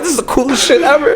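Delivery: high, laughing delight; a drunk fan gushing with laughter after dapping his hero; laughing excitement. Retold or imitated. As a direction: gush through a laugh.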